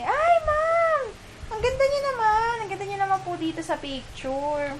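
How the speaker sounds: high, loud gushing praise; gushing staff woman. Retold or imitated. Clerk gushing at the vlogger's ID photo, high and loud. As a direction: gush over her photo, way up high. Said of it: "sabi ni ate".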